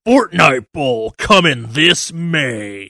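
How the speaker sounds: slow hyped announcer voice; drawn-out monster truck announcer. Acted. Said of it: "I have to keep that fucking voice up now".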